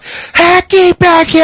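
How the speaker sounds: high, slow, loud battle cry. Acted. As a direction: scream it as you charge in. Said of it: "I'm gonna scream out".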